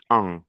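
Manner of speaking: the vowel en held alone and nasal. Shown as a demonstration. Teacher giving students the nasal vowel on its own. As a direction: isolate the sound en, fully nasal. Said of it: "N'oubliez pas la règle".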